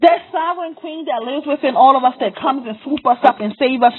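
normal talking voice

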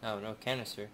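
usual speaking voice

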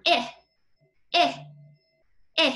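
"eh" with the nose scrunched; short i sound. Shown as a demonstration. Teacher showing the inchworm sound with scrunched nose. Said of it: "scrunch your nose to get that eh sound".

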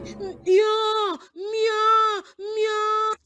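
wailing meow calls; mock cat meows; imitating a cat meowing. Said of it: "The cat is shouting".

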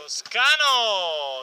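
slow, loud, stretched name call; shouted name. Acted. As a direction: belt out the player's name. Commentator calling a player's name.

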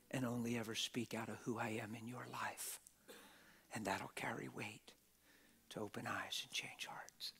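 hushed, near-unvoiced whisper; whispered voice of God. Preacher voicing God's intimate words in a whisper. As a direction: soften to a breathy hush as God speaks.

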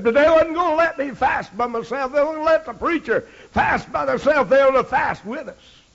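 rising sermon fervor; preaching. Acted. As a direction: press the point to the congregation. Preaching crescendo.

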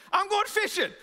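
high, loud, bright announcement; a worker after a bad day, announcing it loudly; cheerful escape. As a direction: go fishing like it's a relief. Bad day at work, going fishing.

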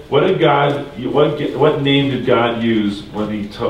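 normal talking voice

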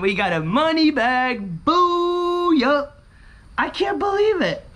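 high, celebratory whoop; elated disbelief. Real, not acted. Winner can't believe the money bag, elated whoop. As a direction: climb high with disbelieving joy.

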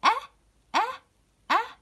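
short "أه" repeated, each one separate; isolated "أه", a pause after each; hamza with fatha. Shown as a demonstration. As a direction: sound out "أه" as a short open vowel. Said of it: "حركة الفتح".

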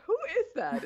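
high-pitched mock bewilderment; puzzled. Athlete-spotter recalling a mistaken first glance, puzzled. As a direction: act out not knowing who that is. Acting out failing to recognise him.

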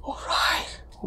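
soft unvoiced whisper. Real, not acted. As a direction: breathe it out quietly. Starting quietly on camera.

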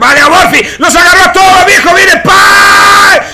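screaming hype, high and loud; fighting game announcer. Real, not acted. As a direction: yell the combo like a fight caster. Huge combo, caster erupts.

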